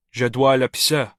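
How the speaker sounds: Noah in French, lightly voiced; a casual French plea to be let go. A narrator's reading. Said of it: "Noah says".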